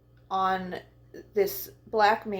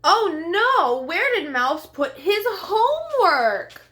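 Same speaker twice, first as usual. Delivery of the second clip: mock dismay; 10 semitones higher, 5 dB louder than usual. Worried exclamation in story.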